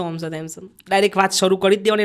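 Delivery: ordinary talk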